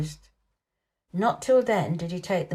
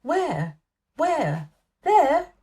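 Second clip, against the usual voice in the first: rising, louder excited cries; peppering questions in thrilled excitement; a boy straining to see, crying out; eager cries; 8 semitones higher, 4 dB louder, much slower than usual. A narrator's reading. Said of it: "he cried".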